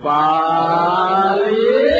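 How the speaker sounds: a long, sung, sickly call stretched very slowly; husband's drawn-out call. Retold or imitated. Sick husband calling his wife from bed, long and sung. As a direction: groan her name out like a feeble patient.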